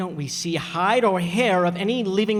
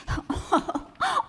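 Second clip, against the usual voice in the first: laughing; 4 dB quieter than usual. Acted. Laughter as uncle flees.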